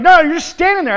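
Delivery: loud, high scolding; mentor yelling. Retold or imitated. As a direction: call him out loudly. Mentor shouting during light exercise.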